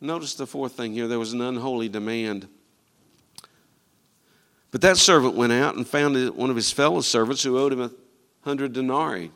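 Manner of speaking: usual speaking voice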